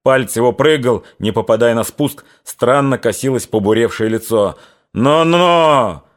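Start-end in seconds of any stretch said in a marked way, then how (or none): 4.9-6.0 high, loud warning "Но-но!"; the sergeant stops Grigory from shooting; threatening cry. Acted. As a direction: bark the warning at him. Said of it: "угрожающе вскрикнул урядник".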